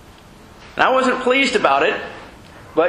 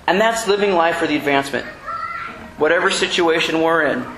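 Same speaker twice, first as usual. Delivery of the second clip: ordinary delivery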